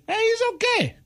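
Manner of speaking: very high, enthusiastic approval; approving. Shown as a demonstration. Host demonstrating inflection to listeners, high-pitched and approving. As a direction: say it like you really like that guy. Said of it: "you like that guy then".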